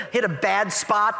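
usual speaking voice

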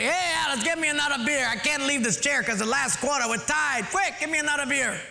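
preacher hams up a beer-belly husband; mock yelling husband. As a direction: holler from the armchair. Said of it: "he yells over to Alice".

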